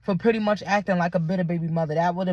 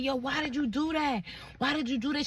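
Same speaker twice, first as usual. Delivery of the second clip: higher, softer imitation of him; retold distressed plea; 6 semitones higher, 7 dB quieter than usual. Boyfriend questioning her about the kids, retold distressed plea. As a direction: imitate his pleading, high and hushed.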